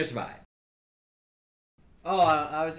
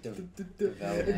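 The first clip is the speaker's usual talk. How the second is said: teasing fake stutter; mock stutter; 5 dB quieter than usual. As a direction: stutter the word on purpose. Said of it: "Do not talk to me about stuttering".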